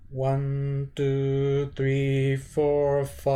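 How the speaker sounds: steady flat pitch, slight build; staircase pitch. Shown as a demonstration. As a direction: step the counts like a staircase. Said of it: "something like this".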